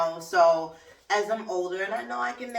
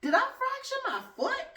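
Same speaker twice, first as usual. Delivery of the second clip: alarmed. Pretend. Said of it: "you might feel like".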